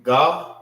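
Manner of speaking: "ga" as a lone rising GAH; "ga" with open ah, tune lifting; syllable by itself. Shown as a demonstration. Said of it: "it's pronounced by itself".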